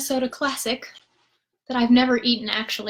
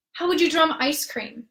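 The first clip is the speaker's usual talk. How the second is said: ordinary talk, nothing marked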